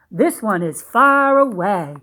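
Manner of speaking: ordinary delivery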